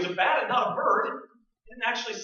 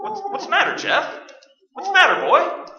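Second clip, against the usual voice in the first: calling the dog; 11 semitones higher, 9 dB louder than usual. Acted. Owner coaxing balking dog.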